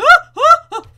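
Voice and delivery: sudden high, loud gasp of fright; startled intake of breath; sharp gasp. Real, not acted. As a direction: gasp as if slipping off a ledge. Thinks she's falling off edge.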